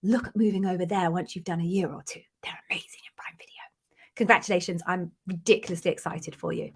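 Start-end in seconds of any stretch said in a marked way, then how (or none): 2.4-3.6 fast confiding whisper; whispered aside. Real, not acted. Host confiding to a viewer about Prime Video, whispered. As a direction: lean in and whisper it quickly.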